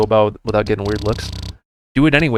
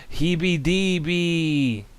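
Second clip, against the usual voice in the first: slow higher announcement; drawn-out name; 7 semitones higher, much slower than usual. Pretend. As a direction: stretch the name out. Names cousin puppet drawn out.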